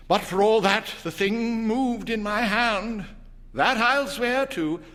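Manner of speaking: husband insisting to wife the paw moved; insistent old man. A narrator's reading. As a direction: swear to it firmly.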